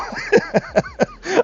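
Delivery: a burst of real high laughter; laughing. Real, not acted. Commentator laughing at Castle's bad luck, real laugh. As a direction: let a real laugh break out at the situation. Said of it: "I'm not laughing at you".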